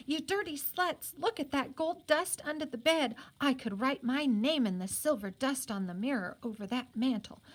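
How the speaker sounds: high, haughty scolding rant; scolding mistress. Retold or imitated. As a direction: berate the maids imperiously. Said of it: "imitating what he thinks Missus Light would say".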